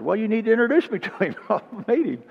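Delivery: high, quick and laughing; pleading to be introduced, high and fast; eager request. Retold or imitated. Speaker asking to meet him, high eager and laughing.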